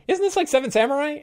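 squeaky mock-suspicious "isn't this like Seven Samurai?"; mocking player. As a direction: ham up the players catching him out. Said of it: "And my group is like".

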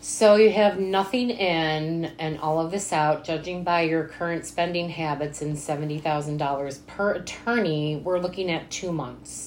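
deadpan accountant. Retold or imitated. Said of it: "accountant's like".

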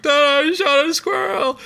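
high, slow mock sob to dad; imitating crying kid. Kid runs crying to dad, imitated in a high, slow sob. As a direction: replay a child's tearful confession. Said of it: "I instantly just started crying".

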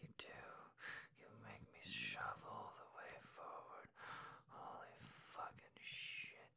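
breathy, close whisper into the ear; hushed, heated bedroom whisper; intimate whisper. Acted. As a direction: whisper it like a lover pressed close.

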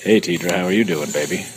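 low crooning pet voice; patronizing. Acted. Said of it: "Whoa, baby. What the hell?".

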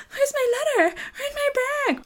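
high, loud mock-panicked wail; hammed-up cry over missing things; frantic searching. Acting out frantic search for bag.

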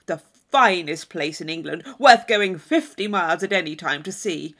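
a hint of the braggart; lightly voiced Thorpe. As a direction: oversell it a touch. Thorpe boasting.

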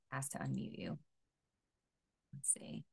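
whispered aside off mic; soft unvoiced host aside. Real, not acted. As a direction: murmur to yourself while fiddling with settings. Host aside while unmuting guest.

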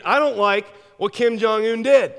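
indignant protest. Acted.

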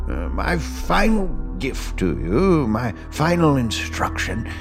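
slow, grave farewell of a mentor; solemn old master. Acted. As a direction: speak each word like a last blessing. Master's farewell speech over music.

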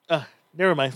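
ordinary talk

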